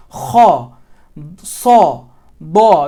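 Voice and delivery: kha, sa, da, each loud with a full, round ah; mouth full of air. Shown as a demonstration. Tutor demonstrating fullness of the mouth, thick and loud. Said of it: "as if your mouth is full of air".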